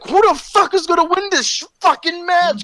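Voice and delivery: high, worked-up question; excited disbelief. Retold or imitated. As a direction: lose it over the match.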